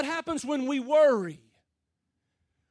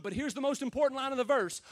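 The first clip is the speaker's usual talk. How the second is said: ordinary delivery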